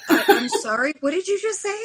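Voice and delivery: as if she can't believe her ears, pitch jumping up; retold disbelief. Retelling her reaction to the cashier's claim, shocked and incredulous.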